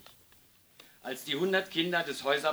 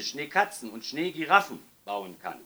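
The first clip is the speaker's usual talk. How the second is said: ordinary delivery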